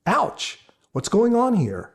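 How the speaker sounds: high, startled wince; mock ouch. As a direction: react as if the words stung.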